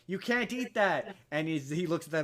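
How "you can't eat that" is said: high, loud mock panic; imitating frantic family, much higher and louder; alarmed warning. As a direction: shout the warning, hammed up. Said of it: "they're like".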